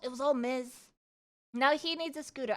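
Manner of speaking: normal talking voice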